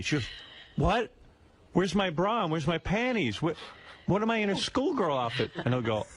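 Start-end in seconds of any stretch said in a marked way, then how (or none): 0.8-5.5 higher-pitched mock bewilderment; confused. Man imitates woman finding her clothes changed, confused. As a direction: imitate her baffled, indignant questions. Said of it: "she's all confused".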